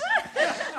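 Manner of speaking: a short high yelp of laughter; quick squeal of laughter. Real, not acted. Preacher laughing at grandmother's scolding.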